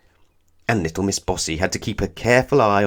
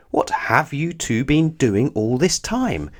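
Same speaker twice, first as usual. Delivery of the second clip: slow, high dismayed exclaim; 6 semitones higher, slower than usual. Acted. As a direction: demand an answer in shock. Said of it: "exclaimed Little Miss Christmas".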